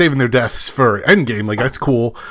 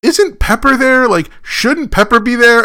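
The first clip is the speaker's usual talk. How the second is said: higher, slow puzzled question; puzzled wondering; 7 semitones higher, slower than usual. Retold or imitated. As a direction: wonder aloud where she is. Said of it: "you're thinking".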